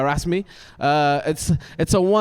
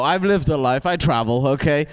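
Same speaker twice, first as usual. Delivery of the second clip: ordinary delivery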